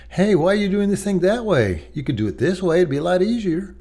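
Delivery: high, pointed correcting voice; harsh matter-of-fact tone. Retold or imitated. Father correcting son with a pointed, harsh edge. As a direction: pick at his son's way of doing it. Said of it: "And that's how I would say it".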